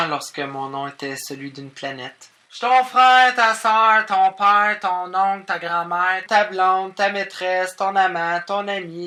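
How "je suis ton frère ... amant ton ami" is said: high, loud, then easing down; impassioned rattled-off list. Acted. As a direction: reel it off in one emphatic run. Breathless list of everyone she is. (énumération exaltée, voix haute)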